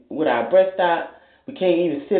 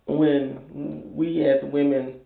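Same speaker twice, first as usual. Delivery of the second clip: ordinary talk, nothing marked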